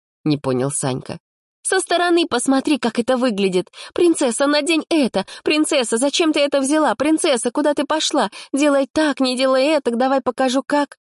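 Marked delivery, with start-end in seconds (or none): 1.6-11.0 very high, scornful parody of fussing; mock his nagging; high sarcastic mimicry; mocking girl. A narrator's reading. Polina mocks his bossiness.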